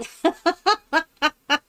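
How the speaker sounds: high, stuttering giggle; incredulous staccato laughter; real amused laugh. Real, not acted. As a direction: crack up in high little bursts. Amused by a long Welsh word.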